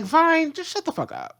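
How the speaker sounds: a high, fed-up jab at Vine; exasperated. Real, not acted. Fan scolding the character Vine, high and exasperated. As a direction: tell him off with high exasperation.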